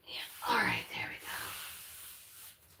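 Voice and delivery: slow soft whisper while cleaning. Real, not acted. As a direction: murmur it under her breath.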